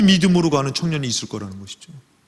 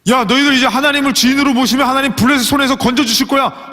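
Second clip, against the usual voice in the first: raised, loud rallying call to the people; rousing call; 10 semitones higher, 5 dB louder than usual. Acted. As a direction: call out to the whole nation, much higher. Preacher voices Samuel calling Israel. (백성을 향해 외치는 높고 큰 목소리)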